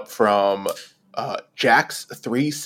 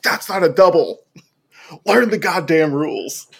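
mock-angry ref-berating protest, pitched up; higher, heated scolding; indignant courtside outburst; 5 semitones higher than usual. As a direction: re-enact an outraged protest at a bad call. Re-enacting himself yelling at officiating player.

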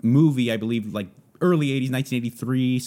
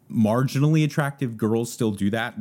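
normal talking voice

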